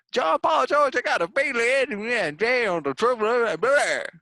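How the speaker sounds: high, loud Southern Beatle caricature; country Southern Beatle. Acted. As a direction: holler it as a hammed-up country Beatle. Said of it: "That's what he talks like".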